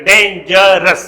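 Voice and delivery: ډینجرس slowly in three separate beats, ډین-جر-رس; syllable by syllable. Shown as a demonstration. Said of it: "دا درې سیلیبل دی". (ډینجرس ورو ورو، په درې جلا سیلیبلونو)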